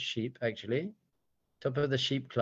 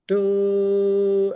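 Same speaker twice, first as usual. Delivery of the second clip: long, steady, loud horn note; shofar blast; 6 semitones higher, 6 dB louder than usual. Shown as a demonstration. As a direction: imitate a ram's horn with one held "doo". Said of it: "the roar is the shofar".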